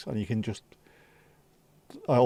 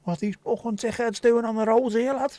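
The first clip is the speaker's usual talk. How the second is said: Scouse accent; 11 semitones higher than usual. Shown as a demonstration. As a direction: swear at the road in a Scouse accent. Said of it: "swearing in a Scouse accent".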